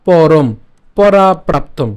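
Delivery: emphatic slow recital; slow and loud demo. Shown as a demonstration. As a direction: stretch out each syllable for the class. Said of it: "উচ্চারণ কেমন হচ্ছে?".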